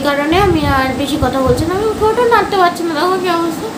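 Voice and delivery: stiff barely moving lips; restrained careful speech; through a face mask. Real, not acted. Seen 1: Sheet mask covers face while talking; removed by 8.7.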